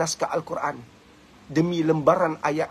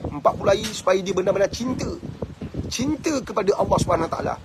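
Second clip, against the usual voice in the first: normal talking voice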